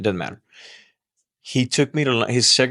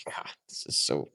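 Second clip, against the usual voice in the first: whisper; 6 semitones lower, 9 dB quieter than usual. Real, not acted. Aside while recalling offense.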